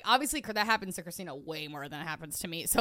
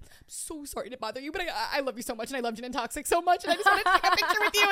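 lightly voiced nervous girl, rushing faster, higher at the end; nervous fan; 6 semitones higher, 5 dB louder, faster than usual. The fan asking for a picture, lightly voiced nervous gushing. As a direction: gush like a shy fan. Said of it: "so nervous".

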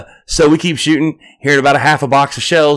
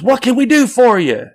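calling out; 11 semitones higher than usual. Retold or imitated. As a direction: call down to the officer, high. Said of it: "He goes".

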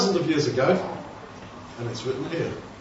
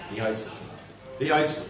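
sulky mutter; 5 semitones lower, 10 dB quieter than usual. Retold or imitated. People's sulky reply.

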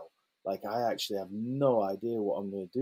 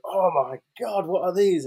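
mock horror at a sour sweet, higher; hammed-up shock; 5 semitones higher, 5 dB louder than usual. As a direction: overplay the shock to amuse the kid. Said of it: "pretending and playing up".

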